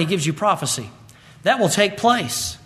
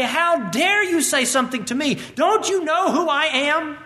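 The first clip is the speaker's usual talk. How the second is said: higher, indignant huffing in a put-on voice; mock outrage; 7 semitones higher than usual. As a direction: play the offended big shot, higher. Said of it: "we are outraged and say".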